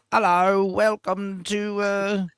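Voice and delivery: a Downton Abbey butler welcoming guests; posh period English. Retold or imitated. Mimicking a period-drama English accent. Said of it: "exaggerated, uh, period piece".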